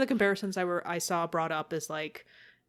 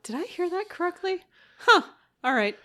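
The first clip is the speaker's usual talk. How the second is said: higher, puzzled voice, as if unsure he heard right; incredulous double take; 11 semitones higher than usual. Retold or imitated. Podcaster mimicking his puzzled reaction to the dialogue, incredulous. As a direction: ask it like you just misheard something odd.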